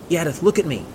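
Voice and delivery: high, loud demand for attention; urgent yell. A narrator's reading. Gunhild to her sobbing friend in the storm, yelling. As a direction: yell to reach a panicking friend. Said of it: "she yelled".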